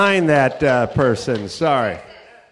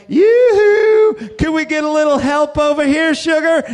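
high sing-song yoo-hoo, much higher and louder; prostitutes calling out; 9 semitones higher, 4 dB louder than usual. Retold or imitated. Prostitutes calling out to men crossing the street, high and flirty. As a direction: coo at them like a streetwalker. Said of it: "three of them went like".

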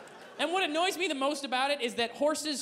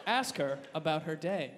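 usual speaking voice

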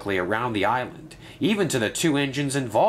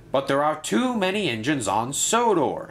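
higher, mildly insistent voice; firm objection; 6 semitones higher than usual. A narrator's reading. Gordon objects to keeping extra engines, mildly firm. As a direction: put your foot down lightly. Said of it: "said Gordon firmly".

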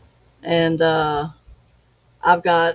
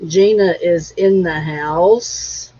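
normal talking voice

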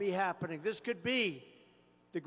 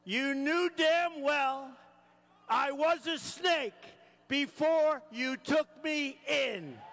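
grinning taunt; 6 semitones higher, slower than usual. Acted. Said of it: "with a grin".

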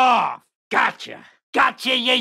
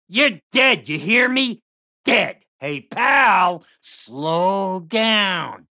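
taunting, aggressive doll villain voice; menacing killer doll. Acted. Killer doll yelling at fleeing victim, taunting menace. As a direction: bark threats like a killer doll chasing prey.

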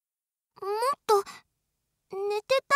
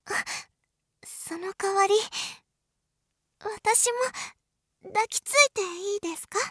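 ordinary talk, nothing marked

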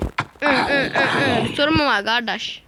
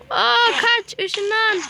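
much higher, louder panicky urging; real gamer panic, higher and louder; excited urging; 8 semitones higher, 5 dB louder, slower than usual. Real, not acted. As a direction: yell at the pet to teleport away. Mount in danger, urging escape.

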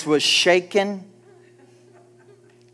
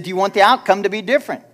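pressing the question louder and faster; a preacher repeating himself with more force; 5 semitones higher, 6 dB louder, much faster than usual. Shown as a demonstration. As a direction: push it at the congregation. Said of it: "I said".

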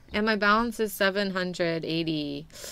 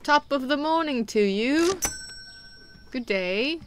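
hammed-up, higher British greeting; cheery mock-British lilt; British accent; 6 semitones higher than usual. Shopkeeper hailing Batman's butler, playful British accent. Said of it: "work on my British accent".

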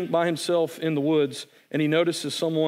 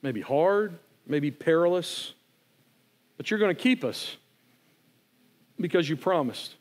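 closing prayer. Real, not acted. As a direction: pray it gently over the congregation.

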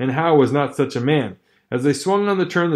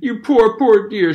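much higher, louder cooing; sympathetic cry; 8 semitones higher, 5 dB louder, slower than usual. Acted. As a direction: pity the dog. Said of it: "she cried sympathetically".

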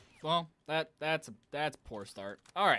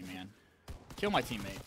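ordinary delivery

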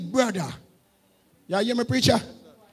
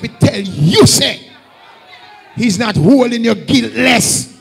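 rising, shouted warning from the pulpit; loud preaching warning; 3 semitones higher, 11 dB louder, slower than usual. Acted. Preacher warning adulterers, climbing to a shout. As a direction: hammer the warning home to the sinners. Preacher warns of God's judgment.

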